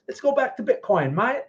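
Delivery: high, lilting mock Australian accent. Streamer repeating himself for fun in a mock Australian accent. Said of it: "I sound Australian, right?".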